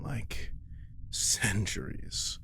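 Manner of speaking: ordinary delivery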